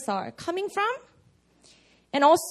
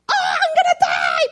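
much higher, shouted mock panic; 7 dB louder than usual. A pastor mocking a panicking friend's post with a high shriek. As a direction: shriek it like a hammed-up panicking teen. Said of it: "feed is like".

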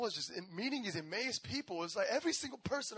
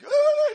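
mock startled yelp; 18 dB louder than usual. Person feeling the Spirit.

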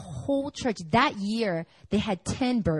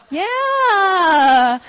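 mock weary yeah; 9 semitones higher, 9 dB louder than usual. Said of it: "And I'm like".

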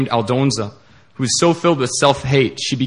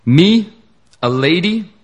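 scoffing, pitched up high and loud; mock-incredulous retort; sarcastic; 6 semitones higher, 5 dB louder, slower than usual. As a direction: throw the word back at him in disbelief. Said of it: "she sarcastically responds".

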